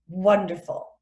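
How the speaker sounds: usual speaking voice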